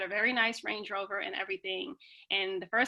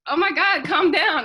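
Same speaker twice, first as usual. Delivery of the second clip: raised, louder exasperated plea to calm down; telling an overexcited crowd to settle, loudly; 7 semitones higher, 10 dB louder than usual. Retold or imitated. Retelling her reaction to screaming women at the meeting, loud and exasperated.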